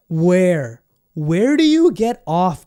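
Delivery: loud, slow, indignant challenge; outraged shout. Real, not acted. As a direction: call them out, loud and slow. Outraged at list makers.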